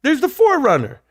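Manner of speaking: put-on a boy pointing out the stolen truck from the car; excited kid's call. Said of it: "I yell out".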